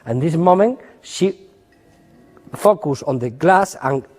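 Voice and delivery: ordinary delivery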